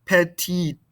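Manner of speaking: "Petite" with a clearly released final T; sounded final T. Shown as a demonstration. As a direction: close "Petite" on an audible T. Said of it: "pronounce this ending consonant".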